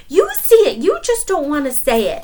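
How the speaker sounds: loud, high accusation; angry. Acted. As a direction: stamp the words out in fury. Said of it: "Anansi stomped his legs".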